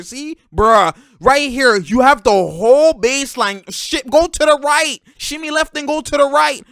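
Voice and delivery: loud, high frustrated instruction; worked-up and emphatic; exasperated coaching. Real, not acted. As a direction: shout the correct move at the player. Berating the player over missed baseline.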